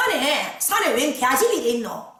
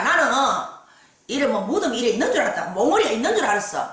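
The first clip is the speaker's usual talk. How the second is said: normal talking voice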